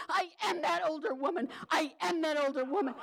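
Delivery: high, urgent, clutching her shoulders; frantic confession. Retold or imitated. Grabbing the girl's shoulders, frantic confession. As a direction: blurt it out in a panic.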